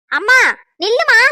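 loud, high call to stop; urgent calling out. Acted. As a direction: call after a mother walking out. Calls after mother walking out. (பதறிக் கூப்பிடும் குரல்)

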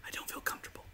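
unvoiced, embarrassed whisper. Real, not acted. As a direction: breathe it out as a shy whisper.

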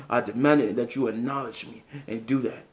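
low, demanding chief voice. Pretend.

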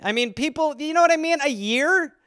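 ordinary delivery

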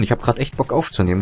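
ordinary talk

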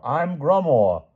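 high, slow called-out introduction; yelling. A narrator's reading. Sir Grummore introduces himself, yelling. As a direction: holler it politely, stretched out. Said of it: "yelled Sir Grummore".

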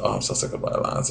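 breathy whisper, barely audible. Real, not acted. Vlogger whispering to viewers she's sick of the song. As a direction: whisper it to yourself, worn out. Echoes the comment title herself.

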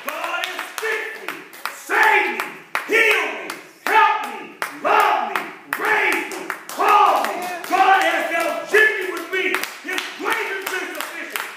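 high, driving sermon testimony; full-force preaching. Real, not acted. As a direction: punch each phrase out high to a congregation.

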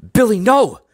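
high, loud warning shout; urgent high-pitched yell; alarmed cry. A narrator's reading. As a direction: cry out to stop him. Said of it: "cried Doctor D".

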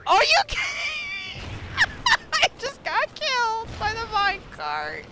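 very high-pitched laughing disbelief; incredulous laughing squeal. Real, not acted. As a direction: squeal it through helpless laughter. Absurd death by minecart.

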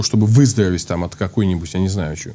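ordinary talk

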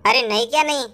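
normal talking voice

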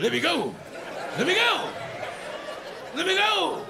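high yelled "let me go!" that breaks into a laugh; serious yell. Retold or imitated. Comic retelling being held down, pleading yell. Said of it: "Then I got serious".

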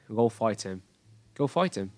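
British "Go fight him" with crisp released T; British accent. Shown as a demonstration. Teacher contrasts accents for students, British pronunciation. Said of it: "giọng British English nó sẽ khác ấy".

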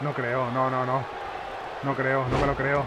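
low, soft muttering to himself; quiet disbelief. Real, not acted. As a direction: drop the voice in disbelief. Muttered disbelief during the game. (murmullo incrédulo)

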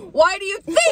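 high, loud acted comeback; exasperated retort. Girl retelling her answer about the pants, exasperated mock retort. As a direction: throw it back as if obvious, about to laugh.

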